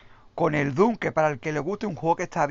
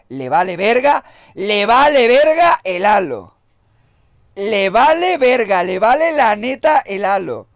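loud, higher emphatic yelling; heated rant; 6 semitones higher, 12 dB louder than usual. Real, not acted. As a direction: hammer home that Gears beats Halo. Ranting that Gears beats Halo. (gritando con desprecio)

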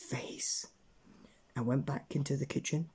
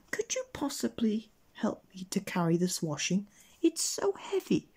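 put-on high, thin, pleading old-woman voice; old washerwoman; 8 semitones higher than usual. A narrator's reading. Old washerwoman asks help with washing, high pleading voice. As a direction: plead weakly, climbing higher at the end. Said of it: "he met an old washerwoman".

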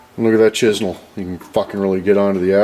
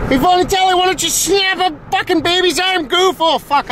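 squealed very high rant; high-pitched outburst. Pretend. Yelling at Vonatelli in a high-pitched outburst. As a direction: burst out far above normal pitch. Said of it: "Fucking bit of an outburst there".

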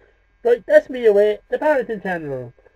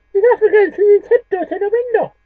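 very high, loud silly voice; squeaky high voice; 14 semitones higher, 7 dB louder than usual. Acted.

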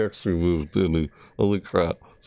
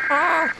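startled shriek. Real, not acted. Sudden scare in game.

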